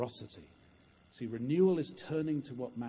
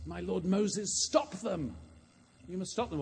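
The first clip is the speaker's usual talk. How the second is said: high-pitched, urgent appeal; raised, insistent protest; Joshua; 7 semitones higher, 3 dB louder than usual. Acted. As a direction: plead in alarm to a leader. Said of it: "And Joshua, the son of Nun, says".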